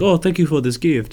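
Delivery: lightly voiced Nigerian accent; a touch of mock Nigerian accent; imitated Nigerian accent. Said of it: "that's how this guy talks".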